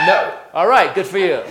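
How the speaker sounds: ordinary talk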